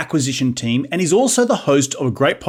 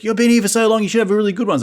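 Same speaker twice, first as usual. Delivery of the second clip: higher mock voice of friends; 9 semitones higher, faster than usual. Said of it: "Everyone's like".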